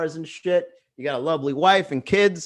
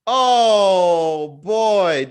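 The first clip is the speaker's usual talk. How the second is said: normal talking voice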